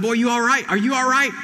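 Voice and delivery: high, loud worried call; alarmed shout. Retold or imitated. Father calling to his son after a crash, alarmed and loud. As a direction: shout down the phone to check he's safe.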